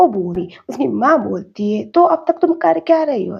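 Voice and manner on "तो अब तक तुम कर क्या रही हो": higher, louder nagging mother; mother on the phone scolding her son, exasperated; mock scolding mother. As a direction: nag your son like a fed-up mum.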